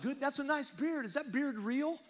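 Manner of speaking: mock congregation yell. Preacher imitating folks back home calling out, lightly mocked. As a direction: toss it out like a fan in the crowd. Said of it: "they yell out".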